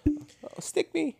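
squeaky high mock fan begging for a sticker; imitating a patron asking for stickers; eager patron. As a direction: chirp it like a giddy bar patron.

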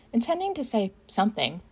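usual speaking voice

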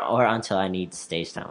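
ordinary delivery

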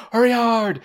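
high yelled sweeping call; curling skip yelling. Pretend. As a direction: shout it down the ice. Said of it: "he can yell the things".

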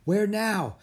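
high, loud, winded question; gasp, then ask out of breath; urgent, puffed from running; breathless. A narrator's reading. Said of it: "Jack asked breathlessly".

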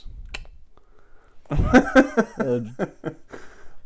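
loud laughter. Real, not acted. Laughing at own joke.

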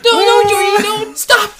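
high, loud squealing imitation; mock panicked shriek. Moviegoers frightened for Georgie, mock shrieking. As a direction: wail at the screen in hammed-up terror. Mimics moviegoers screaming at the screen.

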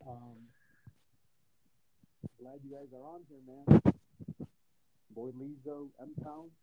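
muffled, far from the mic; bad mic, muffled audio. Real, not acted. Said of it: "you sound real muffled".

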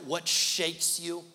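ordinary talk

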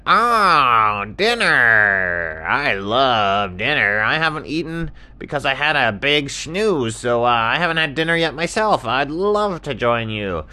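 loud, swooping ostrich voice; gushing, stretched-out cartoon delight. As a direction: draw out the love of dinner.

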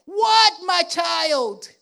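slow, high, loud shout; parent shouting. Retold or imitated. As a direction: call back over the noise. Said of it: "You turn around and says".

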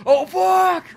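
sudden high frightened shout; scared yell. Retold or imitated. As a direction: yelp it out in fright. Startled in foggy woods.

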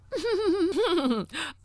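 bright high giggle at herself; a quick high laugh at her own joke. Real, not acted.

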